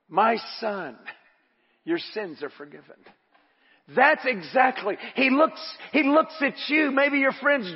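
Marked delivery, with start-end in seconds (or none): 0.1-3.0 soft and low, as Jesus to the man; quiet fatherly tenderness; tender, quiet forgiveness. Retold or imitated. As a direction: hush it down, gently. Jesus speaks tenderly, voice drops.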